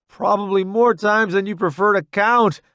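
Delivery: high, loud comeback. A narrator's reading. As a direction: call it back over the gunfire. Replies to wounded friend under fire.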